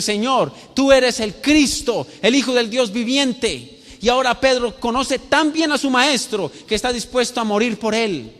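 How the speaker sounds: high, loud pulpit delivery; impassioned preaching. Acted. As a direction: drive each phrase home to the whole congregation. Preacher at full force.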